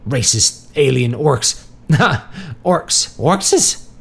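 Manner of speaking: high-pitched, laughing muddle; giggly confusion. A narrator's reading. Marty losing his thread about orcs, giggly confusion.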